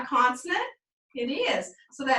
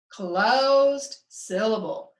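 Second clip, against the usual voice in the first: "closed syllable" very slow, closed held long; stretched, separated "closed syllable"; drawn-out answer; 2 semitones lower, much slower than usual. Shown as a demonstration. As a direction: chant "closed syllable" slowly for the class. Teacher leads class chanting answer.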